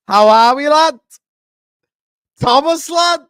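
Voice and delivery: high, loud Scouse-style call of lad; hammed-up Liverpool shout; playful Scouse hail to the guest; mock Scouse greeting. Calling guest in Scouse banter.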